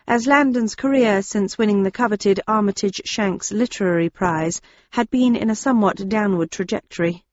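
usual speaking voice

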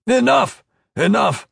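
high, urgent shout; loud high-pitched yell from the crowd. A narrator's reading. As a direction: shout it out to break up a beating. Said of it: "someone shouted".

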